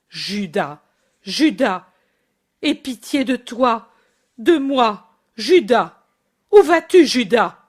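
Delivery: mother pleading as her son runs off; desperate calling. Acted. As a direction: cry out after him as he leaves. Mother calling after fleeing son.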